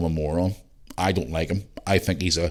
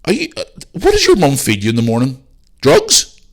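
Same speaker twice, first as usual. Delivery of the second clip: put-on louder, higher sarcastic disbelief to a child; mock incredulous; 6 semitones higher, 7 dB louder than usual. Podcaster mocking a child who thinks it is a cat, loud and scornful. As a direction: scoff at the kid in loud disbelief. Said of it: "and go".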